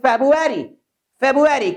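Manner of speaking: February as "feb-u-ery", no r after b; simple February. Shown as a demonstration. Teacher models native February for students, simple and clear. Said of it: "वो February जितना complicated मत बनाइए".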